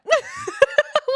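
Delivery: high, loud laugh; loud burst of laughter. Real, not acted. Laughing at her own failure to keep the secret, loud burst of laughter.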